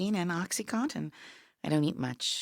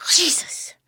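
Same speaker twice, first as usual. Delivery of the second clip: sharp startled whispered cry; startled gasp; 10 semitones higher, 9 dB louder than usual. Real, not acted. Dying woman startled by a knock, sharp whispered cry. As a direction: caught off guard, gasp the word out.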